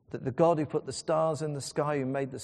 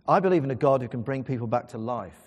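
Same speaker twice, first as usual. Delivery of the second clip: normal talking voice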